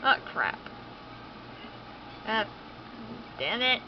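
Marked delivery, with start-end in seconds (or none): none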